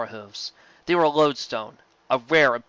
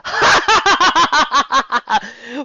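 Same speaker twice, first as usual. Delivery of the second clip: much higher, loud villainous cackle; evil laugh; 13 semitones higher, 9 dB louder than usual. A narrator's reading. Nightmare Moon laughs at the ponies, loud evil cackle. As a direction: cackle with triumph. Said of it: "gave off another laugh".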